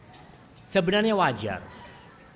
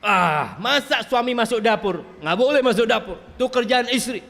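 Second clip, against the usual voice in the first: high, raised voice of protest; scoffing objector; 9 semitones higher, 6 dB louder than usual. Acted. Mimicking men who never enter the kitchen, scoffing objection. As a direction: object indignantly, pitched well up. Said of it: "Muncul orang-orang yang tidak pernah".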